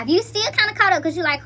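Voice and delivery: ordinary talk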